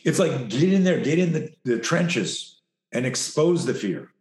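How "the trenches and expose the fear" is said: ordinary talk, nothing marked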